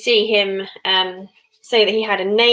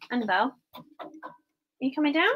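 mummy voice; 4 semitones higher, 3 dB quieter than usual. Real, not acted. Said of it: "That was my mummy voice".

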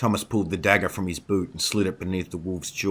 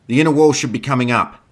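fast, loud, high-pitched shout; yelled call; 7 semitones higher, 11 dB louder, faster than usual. A narrator's reading. Sebastian warns riders charging through smoke, yelled. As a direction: call ahead urgently over the din. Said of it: "Sebastian yelled".

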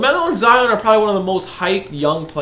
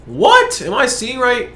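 sudden high-pitched what; surprised; 5 semitones higher, slower than usual. Real, not acted. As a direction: caught off guard, squeak it out. Shocked at a low price.

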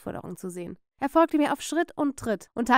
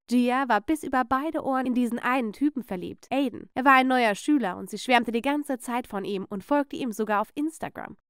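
plain reading voice

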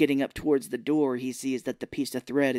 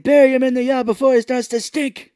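very high, loud screeched order; hammed-up bossy old mother; Mama's mock yell; 11 semitones higher, 10 dB louder than usual. Imitating Mama ordering her son, mock yell. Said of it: "She's like".

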